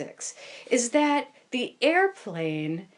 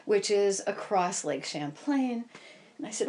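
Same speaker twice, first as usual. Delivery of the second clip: ordinary delivery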